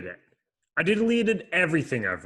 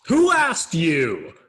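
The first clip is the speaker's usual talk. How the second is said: loud, higher, slow snap back; angry retort; 5 semitones higher, 8 dB louder, slower than usual. Acted. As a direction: cut the voice off, annoyed. [name] snaps back at the voice.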